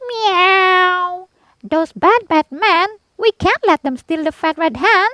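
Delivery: very high meowing cat voice. A narrator's reading. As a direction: mew the warning like an indignant cat. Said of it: "the two cats meowed".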